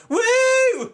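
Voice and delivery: high, loud mock crowd "Woo!"; a shrill-free loud fan whoop; crowd cheer. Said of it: "are like".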